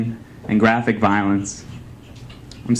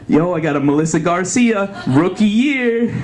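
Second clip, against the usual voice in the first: bro voice; 6 semitones higher than usual. Pretend. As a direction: show it off like a rare card.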